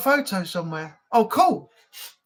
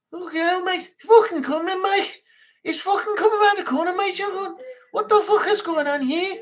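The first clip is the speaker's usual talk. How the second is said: high-pitched, sweary Scouse impression; amateur Scouse accent, pitched up high; 9 semitones higher than usual. Shown as a demonstration. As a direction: put on a high, rough-and-ready Scouse accent. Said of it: "Scouse. I don't remember if it's".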